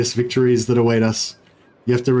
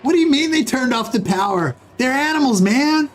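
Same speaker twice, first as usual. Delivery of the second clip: much higher, put-on frantic soldier voice; Hudson voice; 12 semitones higher than usual. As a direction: jump way up into a freaked-out movie grunt. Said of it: "that was a really shit impression".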